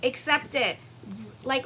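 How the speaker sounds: ordinary talk, nothing marked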